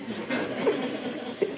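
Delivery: quiet breathy chuckle; soft laugh. Real, not acted. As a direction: laugh softly at the memory. Laughs at own story.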